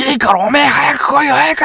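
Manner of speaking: high, loud panicked bark at a friend; terrified senior shouting to run; angry yelling. Retold or imitated. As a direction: yell at him to hurry up. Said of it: "怒鳴り散らしてる".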